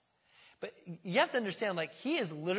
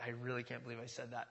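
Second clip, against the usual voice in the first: mortified; 5 semitones lower, 8 dB quieter than usual. Retold or imitated. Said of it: "you're like".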